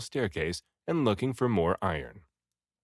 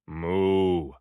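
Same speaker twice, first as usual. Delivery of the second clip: a steady, slightly louder moo; calm reply moo; cow moo; 4 semitones lower, 4 dB louder than usual. Acted. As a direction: answer with an approving moo. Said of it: "replied the cow".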